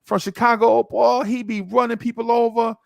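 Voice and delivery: put-on high, eager hype for the young boy; neighbor hyping a rookie. Neighbor hyping Walter Payton to the kid, high and eager. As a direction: sell the rookie like a hidden gem. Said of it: "He's like".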